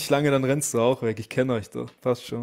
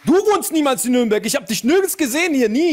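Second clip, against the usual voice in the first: mocking echo; 9 semitones higher, 3 dB louder than usual. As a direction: echo his words back higher and louder, teasing. Mocking echo of Umut's line.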